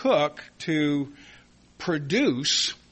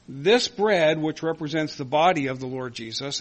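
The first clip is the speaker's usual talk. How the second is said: normal talking voice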